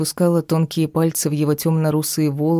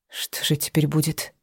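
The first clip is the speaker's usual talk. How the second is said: soft, tearful, barely holding back a sob; sobbing; 5 dB quieter than usual. A narrator's reading. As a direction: ask it through a sob. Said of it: "всхлипнула я".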